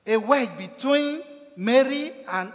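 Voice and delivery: ordinary talk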